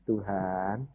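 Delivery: low, soft retold plea to God; understated, sinking lament; mild tearful prayer. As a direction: pray quietly, a touch of weeping. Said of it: "baca Alkitab sampai nangis".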